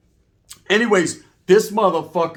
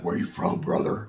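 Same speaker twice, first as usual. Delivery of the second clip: big Samoan cellmate; 11 semitones lower, 6 dB quieter than usual. Retold or imitated. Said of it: "He's like".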